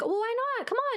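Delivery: high-pitched pushy persuasion; mock staff wheedling; imitated coaxing. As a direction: coax someone, acting it out.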